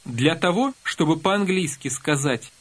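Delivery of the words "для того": ordinary talk, nothing marked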